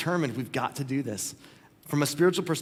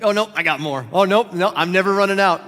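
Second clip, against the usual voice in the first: louder, building quote of a gleeful giver; exuberant giver; 5 semitones higher, 9 dB louder than usual. Retold or imitated. Preacher voicing God handing out more gifts, exuberant. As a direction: imitate someone insisting, louder and higher as it goes.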